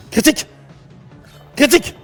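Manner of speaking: high, loud calls; rowdy party animal; excited shouting. Real, not acted. Begging for a crit.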